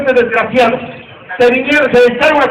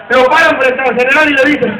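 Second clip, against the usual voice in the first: usual speaking voice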